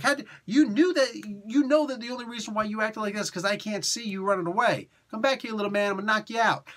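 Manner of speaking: imitating an out-of-character telling-off; going right at him. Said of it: "she just goes right at him".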